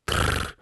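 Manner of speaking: short low rolled trill; a toneless rattle of the tongue; beetle trill. Acted. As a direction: buzz a low insect "trrr". Beetle answers.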